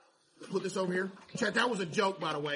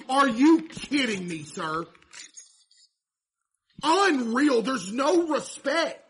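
mock outrage; 6 semitones higher, 8 dB louder, slower than usual. Customer cut in line.

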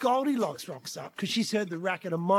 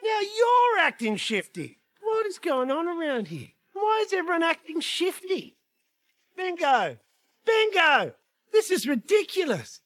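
higher, mounting mock exasperation; frustrated dad at his wits' end; exasperated; 7 semitones higher than usual. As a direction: build from baffled to fed-up calling. Exasperated dad searches for child.

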